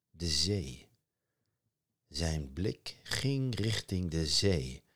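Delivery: plain reading voice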